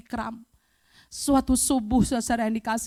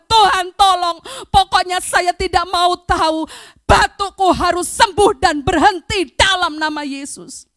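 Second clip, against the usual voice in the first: loud, raised, demanding prayer; crying out to God; 5 semitones higher, 5 dB louder than usual. Retold or imitated. Sufferer commands her cough to stop, insistent cry. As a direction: refuse to take no, calling out. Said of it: "Saya teriak kepada Tuhan".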